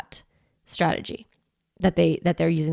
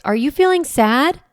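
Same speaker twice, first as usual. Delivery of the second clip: higher, louder and firm; strong voice; 7 semitones higher, 4 dB louder than usual. Shown as a demonstration. Parent naming a melting-down child's feeling, strong voice. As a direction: sound like the adult in charge. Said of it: "you can even use a strong voice".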